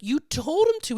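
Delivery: high, indignant retold comeback; hammed-up high protest; mock exasperated friend. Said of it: "And he's like".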